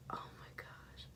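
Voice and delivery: hushed unvoiced whisper; awed whisper to the camera. Real, not acted. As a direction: breathe it softly in awe. Whispering in delight.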